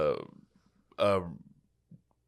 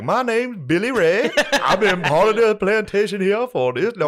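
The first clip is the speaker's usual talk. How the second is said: mock character voice; 12 semitones higher, 7 dB louder than usual. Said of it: "I was like".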